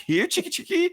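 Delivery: high coaxing "here chicky" call; chicken call. Retold or imitated. Hero taunting hiding dealer, high coaxing call. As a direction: coax a chicken out. Calling chicken in retold scene.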